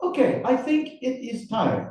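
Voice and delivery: high-pitched, rough Russian accent attempt. Interrogator quizzing his writer guest, in a Russian accent. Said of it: "your Russian accent".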